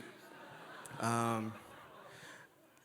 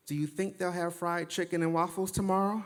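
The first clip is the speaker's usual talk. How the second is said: imitated woman's voice, pitched high throughout; Heather; 6 semitones higher than usual. Woman asking him as he sits down, imitated high voice. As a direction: put on a higher voice for an innocent-sounding question. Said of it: "Heather looks at me and says".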